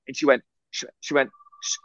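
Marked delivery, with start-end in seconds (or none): none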